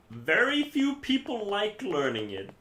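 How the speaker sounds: emphatic high pitch. Real, not acted. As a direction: say it high, dropping on the last words. Emphatic complaint about Danish lessons.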